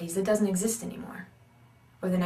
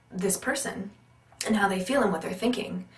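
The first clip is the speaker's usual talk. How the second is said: ordinary talk, nothing marked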